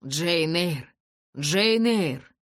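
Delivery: overwhelmed joyful cry; building cry. A narrator's reading. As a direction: cry out her name in disbelief. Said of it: "повторял он".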